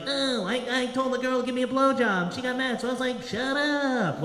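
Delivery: high-pitched mocking imitation of a comedian; Aziz Ansari. Comic mocking another comedian's joke, high-pitched imitation. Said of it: "he was like".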